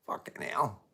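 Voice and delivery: breathy whispered swear under the breath; whispered curse. Real, not acted. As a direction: mutter it in a whisper, half to yourself. Reacting to long stream time.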